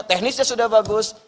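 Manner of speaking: ordinary delivery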